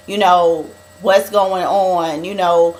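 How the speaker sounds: usual speaking voice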